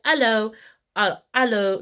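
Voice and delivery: a light put-on British accent on the hellos. Mock British accent as Kelly greeting the man. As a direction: try out a British hello, searching for it. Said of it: "had to put on, you know, a British accent".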